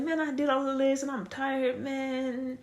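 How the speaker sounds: high, mock-weary whine; tired whine. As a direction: drag it out, sorry for yourself. Tired self complaining on the bus.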